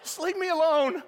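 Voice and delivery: high, loud, laughing mock snap at the crowd; grumpy runner. Pastor mimics an exhausted runner telling cheering fans to go away, high and laughing. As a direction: play the worn-out runner shooing off cheering strangers.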